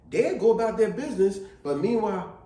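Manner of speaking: ordinary talk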